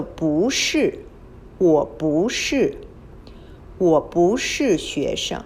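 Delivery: 不 in 不是 said as rising bú before the falling 是; tone change demo. Shown as a demonstration. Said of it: "you need to change the tone".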